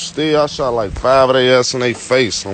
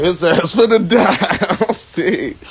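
slow, with laughter breaking in; laughing through it; 4 semitones higher, slower than usual. Retold or imitated. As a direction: chuckle as you say it. Laughing while retelling.

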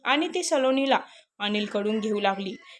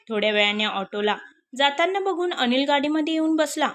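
ordinary delivery, nothing marked

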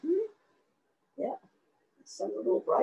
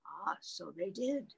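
ordinary delivery, nothing marked

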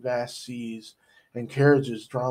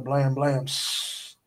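plain reading voice

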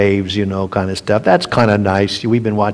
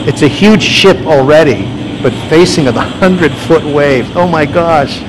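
louder, higher, amazed at the huge wave; excited awe, raised voice; awed excitement; 5 semitones higher, 7 dB louder than usual. Real, not acted. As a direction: marvel out loud at the monster wave. Awed by hundred foot waves.

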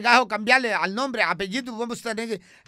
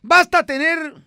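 much higher, louder, comic woman's voice; high put-on character voice; 8 semitones higher, 6 dB louder than usual. Comic character voice chatting with the host. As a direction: play it high and bright like a radio sketch character.

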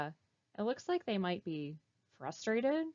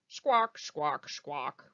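squawking; 6 semitones lower, 4 dB louder, much slower than usual. Retold or imitated. Imitating ducks/band noise.

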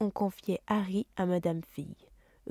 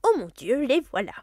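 louder, higher flustered outburst; flustered hurry; 5 semitones higher, 5 dB louder than usual. Acted. Aunt Petunia hearing the doorbell, flustered and hurried. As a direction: rush it out in a fluster. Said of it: "dit précipitamment".

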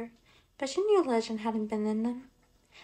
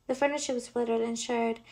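plain reading voice

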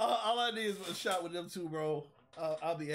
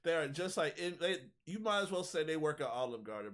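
ordinary talk, nothing marked

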